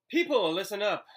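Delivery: high, loud call to the class; a teacher calling her class to order, excited; excited announcement. A narrator's reading. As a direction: grab the room's attention with excitement. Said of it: "announced with excitement".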